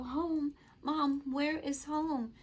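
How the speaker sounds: high, drawn-out puzzled question; retold puzzlement. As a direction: ask Mom in bewilderment, slowly and high. Reenacts puzzled question to mother.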